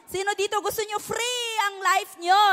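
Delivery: preacher shouting a question to the room; rousing call. Real, not acted. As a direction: fire up the congregation. Rousing the congregation.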